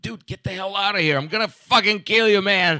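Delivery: a retold outburst, played up loud; mock angry threat. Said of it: "I'm like".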